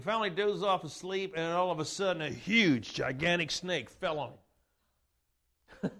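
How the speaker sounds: ordinary talk, nothing marked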